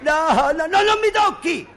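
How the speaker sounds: high-pitched frightened calling out; alarmed, high cries of protest; panicked cry for help. Acted. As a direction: cry out to be rescued from a beating. Boy cries for help, fleeing blows.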